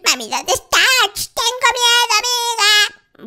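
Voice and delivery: hammed-up baby fear, calling for mom; scared baby. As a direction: cry out like a lost little child. Baby alone waiting for mommy.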